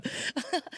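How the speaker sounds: short breathy laugh; quiet real chuckle. Real, not acted. Speaker amused by own bad accent, soft laugh.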